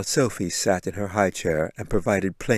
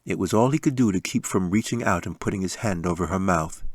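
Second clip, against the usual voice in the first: ordinary delivery, nothing marked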